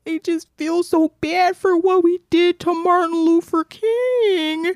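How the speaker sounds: high-pitched wailing imitation of a child; hammed-up tearful whine, drawn out; mock crying. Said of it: "when he was crying".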